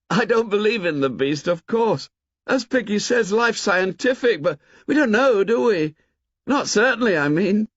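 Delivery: put-on a clever boy half-doubting aloud; thoughtful boy's voice. A narrator's reading. Boy pondering the beast.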